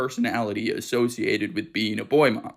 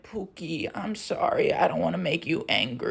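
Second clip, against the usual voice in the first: apologetic mom; 3 semitones higher, 3 dB quieter than usual. Retold or imitated. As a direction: imitate a mother cooing an apology to her son. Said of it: "she goes on to be like".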